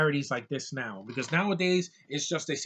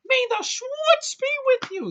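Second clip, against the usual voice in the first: much higher, louder and slower, like a goofy film character; mock movie-character line; 7 dB louder than usual. Reviewer opening his video with a quote from the film, in a goofy high put-on voice. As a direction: belt out the famous spoof line as a put-on character, stretched out.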